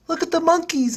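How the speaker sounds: put-on high-pitched little kid voice; young nephew. Six-year-old nephew points out the monkeys to uncle, high child voice. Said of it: "I look at my nephew".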